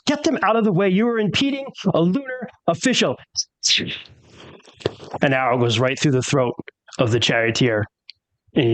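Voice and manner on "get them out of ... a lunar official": high, imperious command; haughty official. Acted. Yolvana orders the old lady off the ford, high and imperious. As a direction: order the peasant aside with lofty disdain. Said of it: "Yolvana's like".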